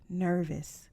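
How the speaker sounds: ordinary delivery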